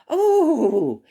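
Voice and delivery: ordinary talk, nothing marked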